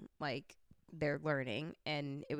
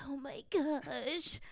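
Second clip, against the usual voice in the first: slow, drawn-out whispered gasp of dismay; aghast whisper; 10 semitones higher, much slower than usual. Retold or imitated. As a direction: whisper it like a guilty realization sinking in. Reacting to a guilt-inducing reel.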